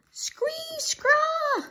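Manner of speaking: very high two-note bird screech; cawing robin. A narrator's reading. Robin caws from the roof, screeching. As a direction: caw shrilly like an alarmed bird. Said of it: "The robin cawed loudly".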